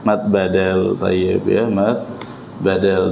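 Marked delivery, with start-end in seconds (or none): none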